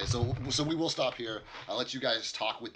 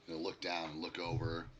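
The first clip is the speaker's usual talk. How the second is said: normal talking voice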